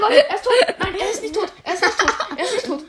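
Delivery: high, loud laughing cheer; laughing shout. Real, not acted. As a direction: yell through laughter. Golem fight, cheering and laughing.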